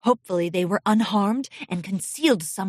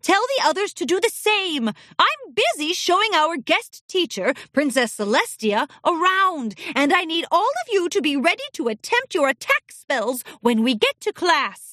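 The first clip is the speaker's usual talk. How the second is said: higher, brisk commanding voice; strict headmistress; 6 semitones higher than usual. Acted. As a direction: give orders briskly. Headmistress ordering colt.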